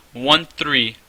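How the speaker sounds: ordinary talk, nothing marked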